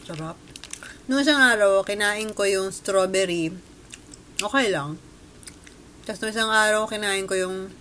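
holding nougat at lips; talking while chewing. Real, not acted. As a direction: mouth partly full of candy. Seen 3: bites at -5 and -2; lips purse as if chewing while talking.

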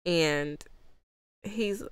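ordinary delivery

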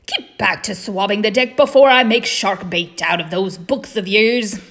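loud pirate father's order; gruff-tempered pirate captain, threatening. Acted. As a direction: order him back to work with a threat.

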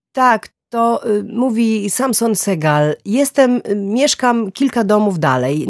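ill-at-ease caller pushing each phrase out high; awkward answering-machine message. A narrator's reading. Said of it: "nie za bardzo lubi mówić do tego rodzaju urządzeń".

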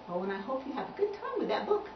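ordinary delivery, nothing marked

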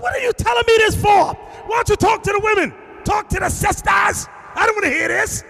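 high, loud exasperated yell; shouted high what-are-you-telling-me-this-for. Retold or imitated. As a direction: yell her away, fed up. Preacher retells yelling at her.

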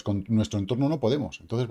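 ordinary talk, nothing marked

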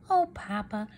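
usual speaking voice

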